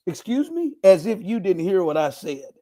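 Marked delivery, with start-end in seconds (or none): 0.0-0.7 pitched way up, snooty and disbelieving; imitating a haughty waitress; mock haughty waitress. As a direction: ham up her put-down. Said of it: "She gonna say to me".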